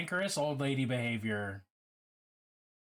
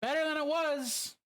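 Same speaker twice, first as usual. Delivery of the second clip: "Better than it was" repeated much higher, stressing "Better"; higher emphatic echo; 13 semitones higher than usual. Real, not acted. Streamer repeats a chat message in a high emphatic echo. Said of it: "Fifty-eight percent plus is better".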